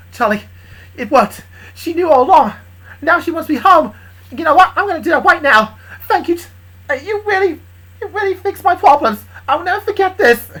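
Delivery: high, loud, elated gratitude; excited thanks. Acted. Caller thanking Charlie after it worked, excited.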